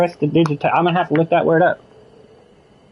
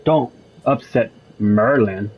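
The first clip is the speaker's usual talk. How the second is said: low Merlin voice; 6 semitones lower, slower than usual. Pretend. Voicing Merlin character in game.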